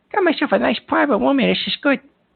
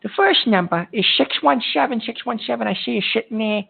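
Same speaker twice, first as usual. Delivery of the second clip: ordinary talk, nothing marked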